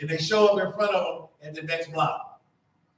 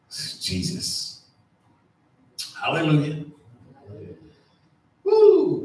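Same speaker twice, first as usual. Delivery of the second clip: usual speaking voice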